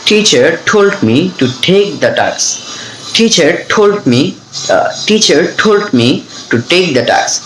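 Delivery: slow phrases, puffed t on "Teacher", "told", "take"; practice-sentence delivery, aspirate "told" and "task"; aspirated T drill. Shown as a demonstration. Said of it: "টির উচ্চারণটা কিভাবে শিখবেন". ("Teacher", "told"-এ ঠ দিয়ে ধীরে উচ্চারণ)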